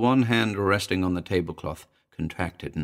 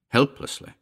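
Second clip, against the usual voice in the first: usual speaking voice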